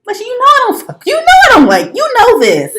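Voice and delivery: very high, loud squeal; mock protest. Woman playing out a snub to her friend, high mock protest.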